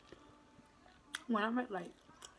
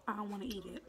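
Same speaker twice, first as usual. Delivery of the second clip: ordinary delivery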